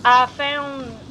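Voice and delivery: words jolted by a hiccup; talking through hiccups. Real, not acted. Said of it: "my hiccups".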